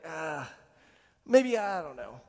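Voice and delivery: reluctant low sigh, then a halting maybe; hesitant doubt. Acted. Next-day doubt about new idea.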